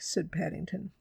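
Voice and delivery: plain reading voice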